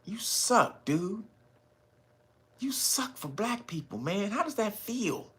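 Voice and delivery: calm measured taunt. Retold or imitated. Brother at the mic taunting the mayor, soft measured taunt. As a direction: cut him down quietly and calmly. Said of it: "very measured".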